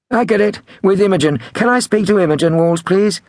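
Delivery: high-pitched prank-call voice; prank caller. A narrator's reading. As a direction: ask innocently in a high put-on voice. Prank caller keeps asking.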